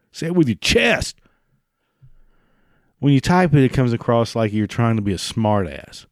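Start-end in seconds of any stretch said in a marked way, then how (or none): 0.1-1.2 mock demand. Ranting at imagined meh-sayer.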